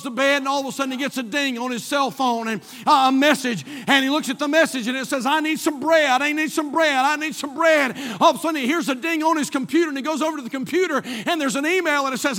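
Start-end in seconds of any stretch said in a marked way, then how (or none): 5.3-8.0 raised-pitch, loud repeated demand; relentless nagging. Retold or imitated. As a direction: hammer the same plea over and over. Acting out repeated nagging messages.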